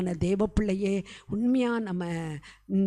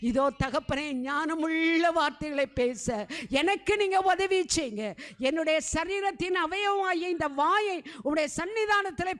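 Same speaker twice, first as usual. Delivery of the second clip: rising, pleading prayer leader's voice, climbing higher as it goes; fervent prayer; 5 semitones higher than usual. Acted. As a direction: plead with God on behalf of the congregation, building in fervour. Leads fervent group prayer. (உருக்கமான மன்றாட்டு ஜெபம், படிப்படியாக உயரும் குரல்)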